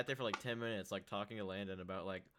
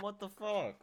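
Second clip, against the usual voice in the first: high-pitched, incredulous protest; indignant outburst; 12 semitones higher, 4 dB louder, slower than usual. Real, not acted. Son reacting to friends calling his mom hot, indignant. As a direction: exclaim it high, in disbelief.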